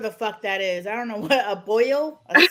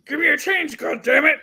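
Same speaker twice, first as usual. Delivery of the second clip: angry beggar; 5 semitones higher, 5 dB louder than usual. Retold or imitated. Imitating beggar banging on window.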